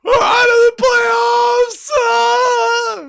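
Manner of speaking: high, loud, slow sobbing shout; a child wailing to his dad that the Red Wings are out; crying wail. Retold or imitated. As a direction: bawl it like a devastated six-year-old. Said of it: "just crying, just crying".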